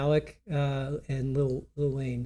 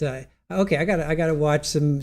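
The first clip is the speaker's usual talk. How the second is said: ordinary delivery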